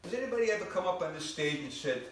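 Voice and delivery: ordinary talk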